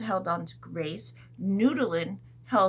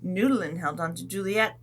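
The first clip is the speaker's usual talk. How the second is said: plain reading voice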